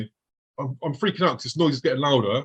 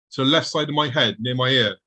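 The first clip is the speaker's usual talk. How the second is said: ordinary talk, nothing marked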